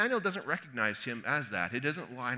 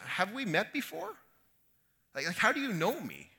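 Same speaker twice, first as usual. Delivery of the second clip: mildly shocked, higher-pitched questioning; startled; 6 semitones higher than usual. Acted. Nathanael to a stranger who knows him, startled and shocked. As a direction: ask it taken aback, pitch lifted. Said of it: "Nathanael's kind of startled and shocked".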